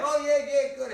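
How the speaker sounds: mock upbeat reply; hesitant friend. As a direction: cover disappointment with fake enthusiasm. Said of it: "[name] answers call".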